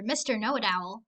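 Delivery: ordinary delivery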